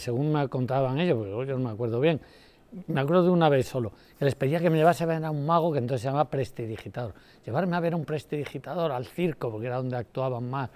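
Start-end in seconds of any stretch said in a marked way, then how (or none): none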